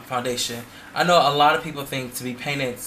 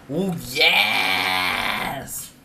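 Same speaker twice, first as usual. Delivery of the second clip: drawn-out ooh yes; 3 semitones lower than usual. Retold or imitated. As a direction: stretch the ooh out and shout the yes. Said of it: "y'all drag out that".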